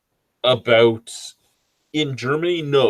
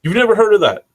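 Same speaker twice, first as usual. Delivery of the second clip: surprised; 11 semitones higher, 6 dB louder than usual. Real, not acted. Incredulous at friend.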